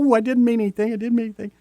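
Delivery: high-pitched protest of innocence; hammed-up backpedal after a bad joke; backtracking. Partner excusing a joke that fell flat, backtracking. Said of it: "backtracking from the joke".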